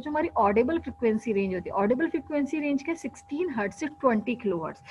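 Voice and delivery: usual speaking voice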